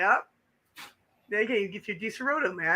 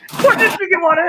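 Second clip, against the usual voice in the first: laughing, loud repeat; 8 semitones higher, 10 dB louder than usual. Real, not acted. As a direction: laugh through the words. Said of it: "repeats with laugh".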